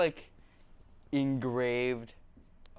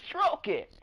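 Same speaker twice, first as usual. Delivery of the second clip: toy voice; 10 semitones higher than usual. Pretend.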